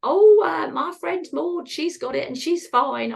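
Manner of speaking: mum's voice pitched high and loud at first; lightly voiced offhand mother brushing it off; imitating mum. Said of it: "all my mum said to me was".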